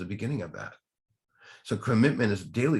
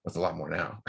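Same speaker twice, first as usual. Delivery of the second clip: ordinary talk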